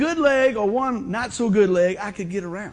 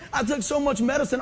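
ordinary delivery

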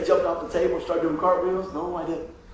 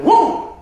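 much higher and louder desperate gasp; bigger gasping noise; 11 semitones higher, 8 dB louder than usual. Retold or imitated. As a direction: gasp harder, desperate for air. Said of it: "I'm going".

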